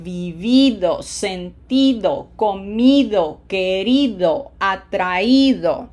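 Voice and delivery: vivido, sentido, comido, querido, atraído, each leaning on the long I; matching emphasised -Í-do on vivido through atraído; stress on the I. Shown as a demonstration. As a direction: chant the list, punching the I of every -ido. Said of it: "And that sounds like".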